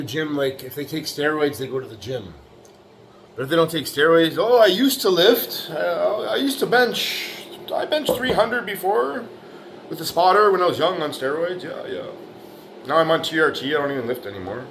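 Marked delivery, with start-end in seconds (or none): 4.4-14.7 mocking lazy gym guy. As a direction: sneer at the excuses in a pitched-up voice. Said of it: "That's what they say".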